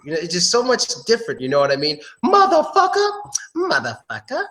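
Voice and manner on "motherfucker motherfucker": rude curse. Shown as a demonstration. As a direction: fling the curse high, then let it sink. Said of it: "it's just different".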